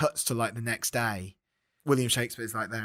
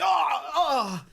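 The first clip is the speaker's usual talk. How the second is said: hammed-up death moans; stabbed groan; 12 semitones higher than usual. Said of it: "he just gets stabbed".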